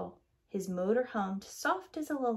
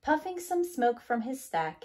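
usual speaking voice

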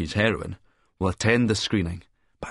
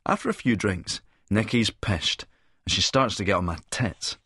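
ordinary delivery

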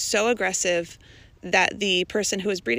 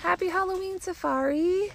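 high pet-talk voice; 11 semitones higher than usual. Real, not acted. Greeting animals in high voice.

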